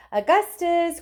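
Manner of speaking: father crying out to his son. Acted. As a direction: call out to him loudly. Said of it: "cried Mister Gloop".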